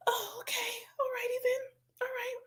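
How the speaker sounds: very high, faint whisper; stunned whisper. Retold or imitated. Answering her spirit team, a stunned high whisper. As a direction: breathe it out, overwhelmed. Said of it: "reacting to spirit".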